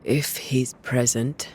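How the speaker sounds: normal talking voice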